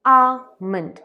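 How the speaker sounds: आमंड as "aa-mund" with no l; "आमंड" with a silent l; correct almond. Shown as a demonstration. Teacher models "आमंड" for viewers, the l left out. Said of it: "इसे हम आलमंड नहीं कहते हैं".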